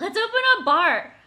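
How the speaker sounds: loud, high eager shout; excited. Real, not acted. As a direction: jump at the idea. Excited agreement to joke plan.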